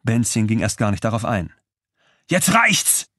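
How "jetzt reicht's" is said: much higher, bellowed outburst; roaring. A narrator's reading. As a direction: roar it, red in the face. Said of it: "brüllte er mit hochrotem Kopf".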